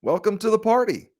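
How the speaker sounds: high, sarcastic "Welcome to the party". Acted. Host mocking the governor, high and sarcastic. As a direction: tease her for arriving late. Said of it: "Or we could say".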